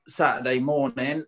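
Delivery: ordinary delivery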